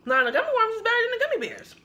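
talking between chews; chewing while talking. Real, not acted. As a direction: mouth partly full. Seen 4: Jaw and lips show chewing motions before, during and after the words.

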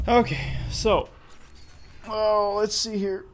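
stretching groan. Real, not acted. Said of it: "Sorry, stretching".

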